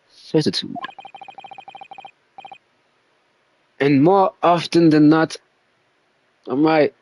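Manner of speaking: lightly posh English detective, self-assured. Acted. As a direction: announce it like a smug English sleuth. Holds detective voice reading dialogue.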